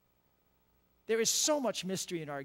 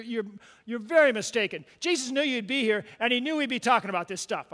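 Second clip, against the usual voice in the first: loud, driving preacher voice; full-force preaching; 5 semitones higher, 11 dB louder than usual. Acted. Pastor tells the congregation the blind man's story, preaching hard. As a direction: preach it out to the back row.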